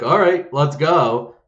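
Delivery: drawn-out, game-on call imitating a crowd; crowd accepting challenge. Crowd answering a shirtless challenger, rallying call.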